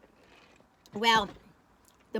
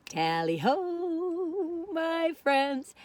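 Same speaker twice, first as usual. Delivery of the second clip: very slow, higher tally ho; drawn-out sign-off; 7 semitones higher, much slower than usual. Real, not acted. Saying goodbye to her friends, stretched and theatrical. Signing off the vlog.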